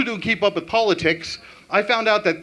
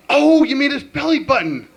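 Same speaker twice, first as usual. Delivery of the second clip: high, loud clueless mom; mom's voice; 8 semitones higher, 4 dB louder than usual. Retold or imitated. Comic explaining to son, high clueless mom. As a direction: play the confused mother, up high.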